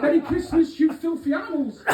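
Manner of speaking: laughing quote. Pretend. Quoted film line sign-off.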